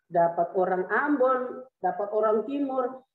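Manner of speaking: usual speaking voice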